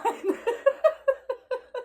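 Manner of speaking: high giggle at herself; high laugh. Real, not acted. Tarot reader laughs at her own emphatic line, giggling.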